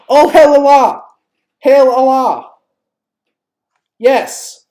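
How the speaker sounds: loud, high cheering; excited cheer. Real, not acted. Excited cheer reading chat.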